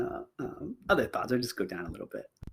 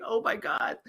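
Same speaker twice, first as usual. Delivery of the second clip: hushed mock alarm; under-the-breath comic dread; terrified grocery boy; 5 semitones higher, 7 dB quieter than usual. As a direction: softly gasp it as the nervous kid. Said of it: "He looks terrified. He's like".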